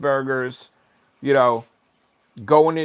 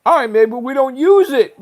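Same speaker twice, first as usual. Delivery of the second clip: ordinary talk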